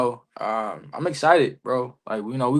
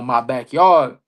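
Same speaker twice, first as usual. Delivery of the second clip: usual speaking voice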